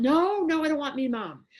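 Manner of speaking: loud, high yelp easing into a pleading protest; panicked child pleading. Retold or imitated. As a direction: imitate a scared little child refusing. Voices the frightened child refusing.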